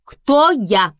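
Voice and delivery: stern, loud cartoon question; Кролик из мультфильма про Винни-Пуха. A narrator's reading. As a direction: ask strictly, playing a cartoon rabbit. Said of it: "в образ кролика из мультфильма".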